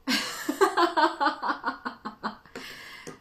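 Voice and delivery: high laugh that trails away; long laugh. Real, not acted. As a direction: crack up at your own story. Laughs at her exaggerated story.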